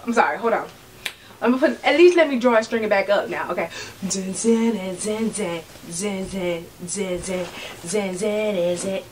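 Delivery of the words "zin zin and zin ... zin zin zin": sing-song zin sounds. Acted. Sound effect while tying string.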